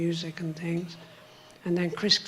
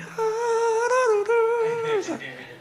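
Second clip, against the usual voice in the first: long, very high held note sliding down; wordless high wail, tailing off; high sung note. Shown as a demonstration. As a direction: show off a high singing voice. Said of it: "now I can sing like".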